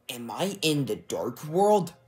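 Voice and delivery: normal talking voice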